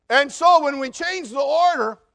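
loud phone talker. Retold or imitated. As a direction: blast the line like a self-important caller ignoring everyone. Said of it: "He just looked at me and went".

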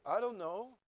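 rising, unsure "I don't know"; wrong version. Shown as a demonstration. Preacher showing how the wife did not answer, rising and unsure. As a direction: ask it, as if puzzled. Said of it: "She didn't say".

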